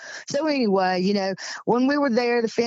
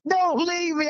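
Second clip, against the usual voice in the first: imitating crying plea; 8 semitones higher than usual. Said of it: "he started crying again".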